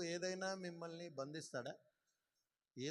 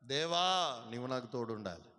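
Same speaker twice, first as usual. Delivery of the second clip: loud prayer; 3 semitones lower, 9 dB louder than usual. Shown as a demonstration. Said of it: "ప్రార్థనలో చెప్పాలి".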